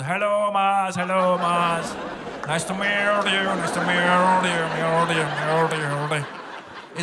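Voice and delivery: Indian father-in-law, slow and lilting; hammed-up Indian uncle greeting; Indian accent. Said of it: "My father-in-law has it".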